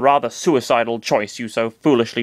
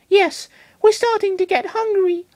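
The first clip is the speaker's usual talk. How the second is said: high-pitched little Pokemon voice, sheepish; Lucario voice. Acted. Lucario admits to his trainer they are hungry, high-pitched. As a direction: squeak it out, pretending to be ashamed.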